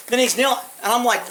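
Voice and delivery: ordinary delivery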